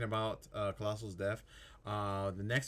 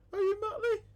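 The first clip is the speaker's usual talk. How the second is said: very high, squeaky, louder question; 6 dB louder than usual. Acted. Child asking the Pirate King, in a squeaky high voice.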